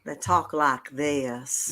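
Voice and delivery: very slow Savannah, Georgia drawl; Southern drawl. Shown as a demonstration. Co-host showing how Savannah family talk, slow Southern drawl. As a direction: stretch it out in a Southern accent. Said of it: "they break it down to two".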